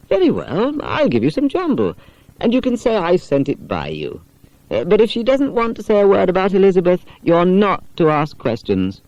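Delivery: mother chatting helpfully to her son; mother's voice. Acted. Mother grants, warns.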